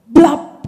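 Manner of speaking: sudden loud "blap"; imitated burst noise. Testifying woman imitating something bursting out, loud burst noise. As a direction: punch out a loud popping sound.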